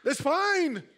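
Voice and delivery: usual speaking voice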